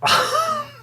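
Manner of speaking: sudden squeaky high-pitched laugh. Real, not acted. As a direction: burst out in a shrill giggle. Reacts to disappointing DVD.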